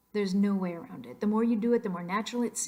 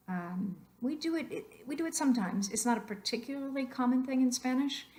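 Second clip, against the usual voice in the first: usual speaking voice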